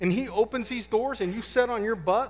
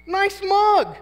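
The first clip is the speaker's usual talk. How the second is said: mock sarcastic gushing; 14 semitones higher, 8 dB louder, slower than usual. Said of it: "And I'm like".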